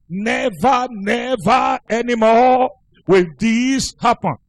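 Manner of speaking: husband promising his wife never again, emphatically; emphatic vow. Retold or imitated. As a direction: swear it, leaning on the nevers.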